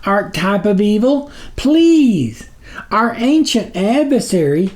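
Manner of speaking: high, slow pleading servant voice; groveling minion. A narrator's reading. Snuffer begging the sublord's patience, high and slow. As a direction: plead upward to your dark master.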